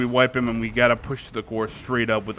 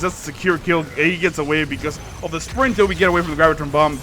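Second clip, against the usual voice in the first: ordinary talk, nothing marked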